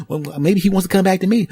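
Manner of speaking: high put-on woman's voice, hopeful; pleading ex. Ex hoping he'll come back, high and pleading. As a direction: plead your case.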